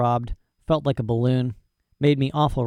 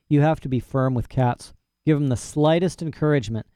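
plain reading voice

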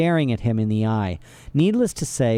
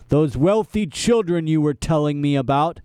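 bald henchman voice; 3 semitones higher than usual. A narrator's reading.

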